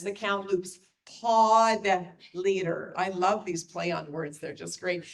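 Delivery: ordinary delivery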